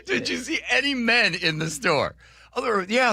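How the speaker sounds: high voice breaking into laughter; high, laughing retelling. Real, not acted. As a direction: talk through the laughter.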